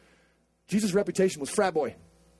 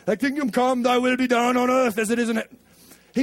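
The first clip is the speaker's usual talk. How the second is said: put-on British accent. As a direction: intone the prayer in a put-on British voice. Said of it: "you have to do it in British accent".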